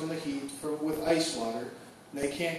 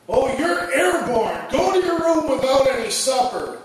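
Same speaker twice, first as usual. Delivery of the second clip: scolding parent plant; 6 semitones higher, 3 dB louder than usual. Acted. Parent plant punishing child.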